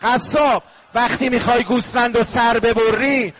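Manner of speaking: rising lament to the butcher; lamenting address. Acted. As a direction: call out to the butcher in grief. Voicing questions to the butcher.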